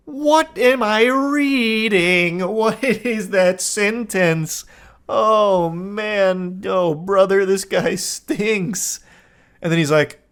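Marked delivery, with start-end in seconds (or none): 0.1-9.0 high, slow, disbelieving groan; incredulous disbelief. Real, not acted. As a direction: drag it out in disbelief at the text. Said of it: "reacting in disbelief".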